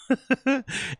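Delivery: sudden high giggle. Real, not acted. Laughing at his ban joke.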